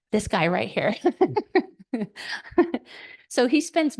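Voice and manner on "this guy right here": ordinary talk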